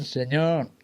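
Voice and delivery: ordinary delivery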